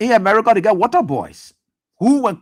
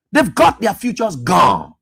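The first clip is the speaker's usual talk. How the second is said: usual speaking voice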